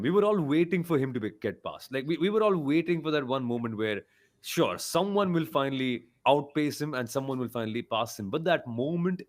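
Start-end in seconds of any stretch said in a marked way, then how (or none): none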